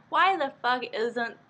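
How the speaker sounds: ordinary talk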